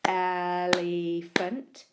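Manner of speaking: slow, with elephant split into claps; clapped syllables. Shown as a demonstration. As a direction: clap out each syllable of elephant. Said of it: "If I say the word and clap it".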